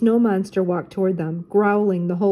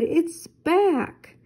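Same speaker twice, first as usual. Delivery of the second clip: frightened cry; 10 semitones higher than usual. Acted. Shaggy sees the monster coming back, high and frightened. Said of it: "Shaggy cried".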